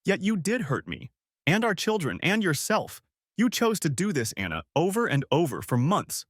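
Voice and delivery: bitter, rising accusation; hurt, pointed anger building. A narrator's reading. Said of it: "I let out a bitter breath".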